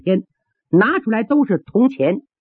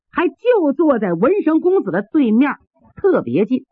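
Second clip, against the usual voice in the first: usual speaking voice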